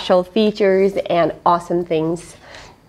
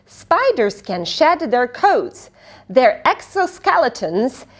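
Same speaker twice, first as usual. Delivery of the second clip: usual speaking voice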